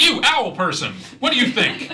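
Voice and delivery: high, loud flower calling down. Acted. Flower loudly asks the owl player, high and loud. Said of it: "very loudly, um, says that".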